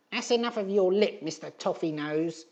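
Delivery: high, loud sneering hag voice; sneering old crone. Acted. Edith snapping at Gribley, a high sneering crone. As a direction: spit the insult like a nasty old woman. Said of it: "sneered Edith".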